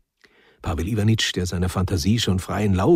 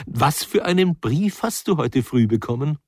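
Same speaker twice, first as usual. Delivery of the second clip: lightly voiced amused wife; wife teasing her sulky husband; 6 semitones higher than usual. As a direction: tease him with a knowing question.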